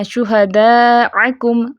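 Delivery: شہداءکم drawn out very slowly with a clean glottal hamza; slow tajweed recitation; Quranic recitation. Shown as a demonstration. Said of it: "حمزہ کو عین نہیں بنانا".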